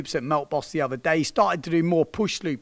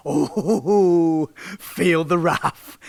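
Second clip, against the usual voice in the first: mock menace; 2 semitones higher, 5 dB louder, much slower than usual. Mock threat about Scousers.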